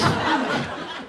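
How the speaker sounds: breathy high giggle; high laugh. Real, not acted. Comic cracking up at her story, high giggle.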